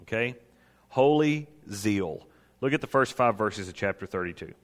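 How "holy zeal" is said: "Holy zeal" drawn out slowly, word by word; slow emphatic repeat. Real, not acted. Preacher repeating the first credential to listeners, slow and emphatic. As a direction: stretch both words of "Holy zeal" for weight.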